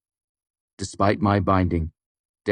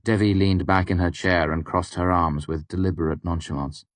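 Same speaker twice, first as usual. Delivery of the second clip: usual speaking voice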